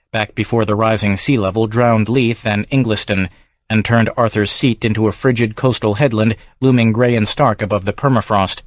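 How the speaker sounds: plain reading voice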